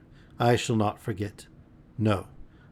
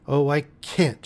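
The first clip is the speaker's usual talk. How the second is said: a touch of sobbing cry; 5 semitones higher than usual. Acted. Girl on the floor crying out in despair. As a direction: cry it out, breaking down. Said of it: "she cried".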